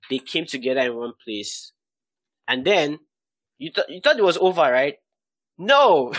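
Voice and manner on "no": emphatic no. Real, not acted. Preacher's dramatic denial.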